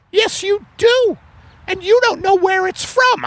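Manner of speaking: very high falsetto wife voice; a high put-on wife, puzzled; Margaret voice. Acted couple skit, wife voice.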